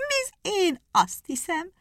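laughing hare. A narrator's reading. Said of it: "kacagott a nyúl".

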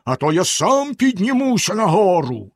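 high, threatening holler. A narrator's reading. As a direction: shout a menacing warning. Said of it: "знову заволав синя борода".

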